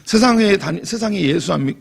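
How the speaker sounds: normal talking voice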